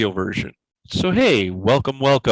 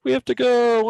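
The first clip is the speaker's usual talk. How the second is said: high panicked; 9 semitones higher than usual. Pretend. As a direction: squeal it out in a panic. Mock dramatic sign-off.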